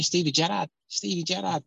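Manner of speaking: put-on Liverpool accent. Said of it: "I'd have to learn a whole new accent".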